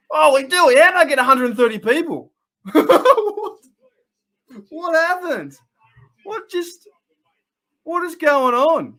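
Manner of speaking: high-pitched shocked outburst breaking into laughter; real astonishment. Real, not acted. Streamer floored by a sudden crowd of viewers, astonished. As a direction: blurt it out, high and incredulous.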